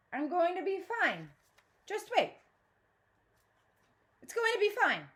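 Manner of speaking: mother talking herself into calm before the meeting; anxious self-reassurance. A narrator's reading. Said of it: "She says over and over".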